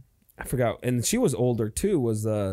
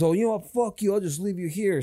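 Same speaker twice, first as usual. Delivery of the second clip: higher, mock angry snap at her; retold angry outburst; 6 semitones higher than usual. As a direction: play the boyfriend telling her off. Acted quarrel outside bar.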